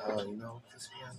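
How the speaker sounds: soft, offhand remark; low-level delivery. Shown as a demonstration. Showing friend the levels of speaking, softly. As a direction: say it softly, barely pushing. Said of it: "It's like levels".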